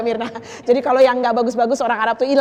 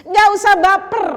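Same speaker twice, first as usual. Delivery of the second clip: emphatic plea; 9 semitones higher, 10 dB louder than usual. Real, not acted. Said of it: "preaching at full force".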